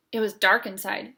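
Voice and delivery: usual speaking voice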